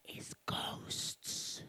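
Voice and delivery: breathy scared whisper; little girl whispering what scares her most; fearful whisper. Acted. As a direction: whisper it like a secret fear. Child whispers her worst fear.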